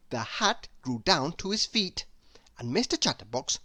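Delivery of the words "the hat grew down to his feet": plain reading voice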